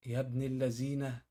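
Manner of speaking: slow, soft marvel at the trick; slow amazed exclamation. Real, not acted. Seeing a knot trick, slow quiet exclamation of amazement. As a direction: drag it out, quietly impressed.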